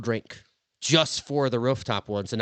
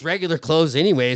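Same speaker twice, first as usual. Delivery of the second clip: ordinary talk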